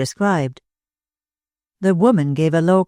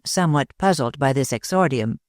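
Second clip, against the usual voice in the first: plain reading voice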